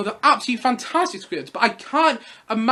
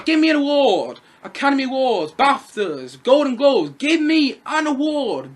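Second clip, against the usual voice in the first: loud, high, emphatic mocking demand; mock award begging; 4 semitones higher, 4 dB louder than usual. Reviewer mocking an actor desperate for trophies, loudly demanding. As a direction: hammer out each prize in mockery. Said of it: "like he's holding up a sign".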